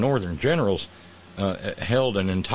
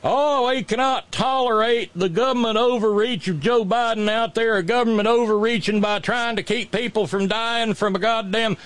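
mock Southern governor; 10 semitones higher than usual. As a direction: ham it up as an indignant Alabama governor. Mocking governor's outraged rant.

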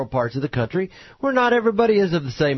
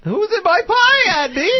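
put-on shrieking old aunt; 4 dB louder than usual. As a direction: wail it out, frantic. Repeats the Aunt Bee wail.

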